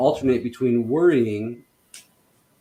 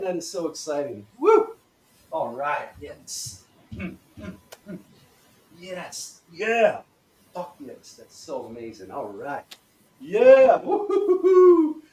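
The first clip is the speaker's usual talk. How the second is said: excited celebration; 4 semitones higher, 5 dB louder, slower than usual. Shown as a demonstration. Coach demonstrates celebrating, gleefully excited. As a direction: savour it, then burst into high whoops. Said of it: "fun or celebrating".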